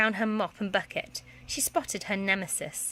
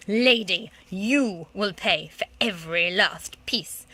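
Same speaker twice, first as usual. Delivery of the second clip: slow, louder menace; stern threat; 5 dB louder, slower than usual. Acted. Museum director threatening the headmistress sternly. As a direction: lay down the verdict.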